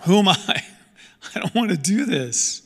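high-pitched mock reluctance; acted "not me" objection with a small laugh; reluctant protest. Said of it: "That's us when we think about evangelism".

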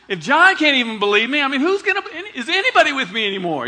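loud, high, exasperated outburst; frustrated Jesus. Pretend. Jesus ranting at John's disciples, mock frustrated and loud. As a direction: rant in mock outrage at John's doubt. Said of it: "he's all frustrated".

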